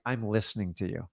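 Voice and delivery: soft reassuring tone of someone truly listening. Shown as a demonstration. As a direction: drop it down and really listen. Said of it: "you gotta switch to".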